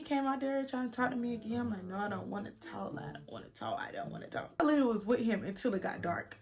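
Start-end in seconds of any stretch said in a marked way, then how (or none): 1.8-4.5 fading refusal. Retold or imitated. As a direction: shut him out, trailing off each time. Refusing boyfriend, repeated.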